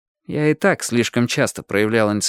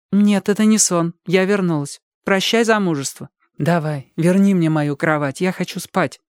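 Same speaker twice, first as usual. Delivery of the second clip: higher, tired and blunt; weary sister voice; 6 semitones higher than usual. Acted. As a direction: sister back home, wants sleep. Therese's quoted lines.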